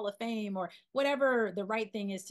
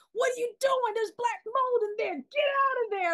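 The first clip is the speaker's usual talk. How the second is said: imitating a shout, much higher and louder; retold yell; 7 dB louder than usual. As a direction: yell a warning from far down the street. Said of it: "Spike yelled from, like, way down the way".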